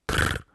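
short low rolled trill; a toneless rattle of the tongue; beetle trill. Acted. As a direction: buzz a low insect "trrr". Beetle answers.

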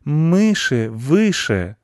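drawn-out chorus slogan. A narrator's reading. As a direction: intone it loftily, stretching each word. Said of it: "сказали они хором".

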